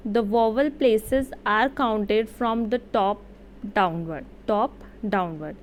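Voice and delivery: very slow, deliberate dictation pace; careful classroom reading of a rule; slow read-aloud. Shown as a demonstration. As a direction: read it out word by word, slowly. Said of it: "मैं read out कर देती हूँ".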